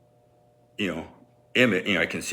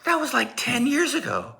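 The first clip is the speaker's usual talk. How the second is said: mom voice. Retold or imitated. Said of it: "She goes".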